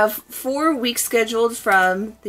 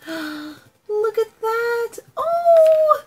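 delighted gasp; 12 semitones higher, much slower than usual. Real, not acted. Vlogger gasping at the box she just opened, thrilled. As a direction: gasp over a lovely parcel. Unboxing a beautiful purple box.

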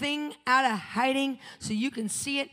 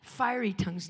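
normal talking voice

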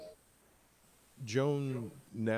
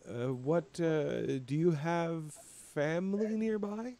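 higher, slower, tentative question; curious Joan; 8 semitones higher, slower than usual. Acted. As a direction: ease into the question, unsure how to ask.

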